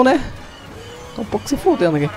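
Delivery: usual speaking voice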